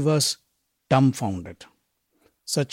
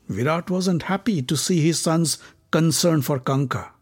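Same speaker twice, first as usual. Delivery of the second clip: plain reading voice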